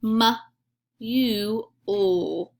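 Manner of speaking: M, U, O said as separate sounds; sounding out words. Shown as a demonstration. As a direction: sound out M, U, O slowly, one by one. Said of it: "try it with me".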